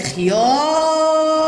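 خيار with a long drawn-out "aar"; yaa pronounced. Shown as a demonstration. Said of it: "الياء في كلمة خيار تنطق". (إطالة آخر خيار)

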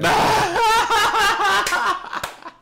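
high, loud burst of laughter. Real, not acted. Host bursting out laughing at the co-host's pun. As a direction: cracks up at the pun.

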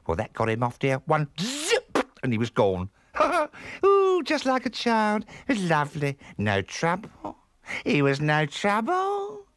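high, swooping character voice with squeals; chatty, solicitous housekeeper. Acted. Missus E advising the aunt about sleep, chatty high voice. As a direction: gossip on in a high, lurching voice. Plays Missus E, story figure.

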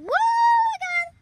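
high, loud whoop of joy; excited shout. Real, not acted. Kid on the swings yells, high and excited. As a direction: cheer at the top of your lungs.

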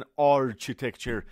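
architecture with ch instead of k; common CH mispronunciation. Retold or imitated. Teacher quoting many friends' mistake, mispronounced with CH. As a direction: imitate the mistake, "ar-chi-tecture".

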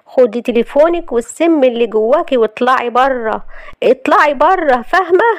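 building from firm anger to a high, loud demand; angry eviction. Acted. Wife ordering out the visitor who hurt her, furious. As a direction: throw her out, angrier with each order.

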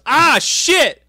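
high, loud startled shout; mock yell. Host acting out Cole getting shoved, yelling. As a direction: yelp it like you got stiff-armed. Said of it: "And he goes".

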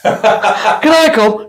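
drawn-out, laughing "can I come?"; hammed-up eager request through a laugh; laughing mock plea. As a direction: beg like an eager kid, giggling.

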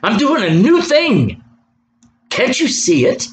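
high, drawn-out emphatic proclamation; emphatic preacher voicing God; emphatic divine declaration. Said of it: "God's emphatic. The Holy Spirit's emphatic".

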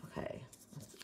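whispering. Real, not acted. Opening the paper slip.

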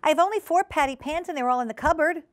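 high, prim hostess voice; insistent hostess Ribby. Acted. Ribby accounting for her patty pans, insistent. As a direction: state it firmly, pitched well up.

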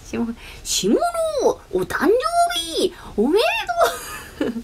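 high, slow, stretched-out cheer; hyped birthday call. Retold or imitated. Streamer mimics a birthday shout-out, over-hyped high call. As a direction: belt out each word high and drawn-out.

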